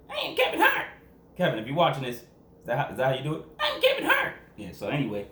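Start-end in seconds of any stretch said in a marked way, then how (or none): 0.1-0.9 much higher, squeaky comedian impression; a squeaky, much higher Kevin Hart impression. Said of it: "like, a squeaky voice". 3.6-4.3 much higher, squeaky comedian impression; a squeaky, much higher Kevin Hart impression. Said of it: "like, a squeaky voice".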